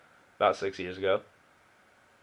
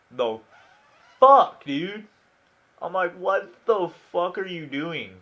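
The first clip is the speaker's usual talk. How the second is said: incredulous; 5 semitones higher than usual. Retold or imitated. Shocked at kid banging head.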